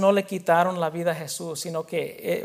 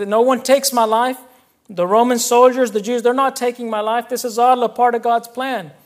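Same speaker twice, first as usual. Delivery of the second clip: louder, driving sermon delivery; forceful preaching; 4 semitones higher, 6 dB louder than usual. Acted. Pastor driving home his point to the church, forceful preaching. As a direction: preach it out to the congregation.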